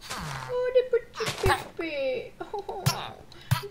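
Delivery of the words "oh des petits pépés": calling it out loud. Acted. Player calling out the villagers, loud. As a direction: call out the little villagers. Spotting little old villagers.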